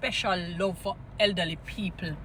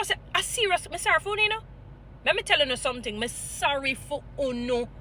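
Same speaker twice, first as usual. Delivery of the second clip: ordinary talk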